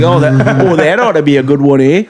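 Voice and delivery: put-on comic Canadian accent. Woman in a hockey joke, put-on Canadian accent. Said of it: "do my Canadian accent".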